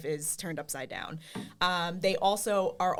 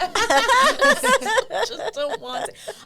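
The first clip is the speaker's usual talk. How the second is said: high, loud and slow, laughing; 9 dB louder, much slower than usual. Real, not acted. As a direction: laugh out the confession. Laughing admission.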